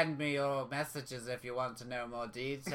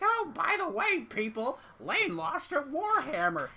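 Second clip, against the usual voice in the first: mocking imitation; 9 semitones higher than usual. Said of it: "you were gonna be like".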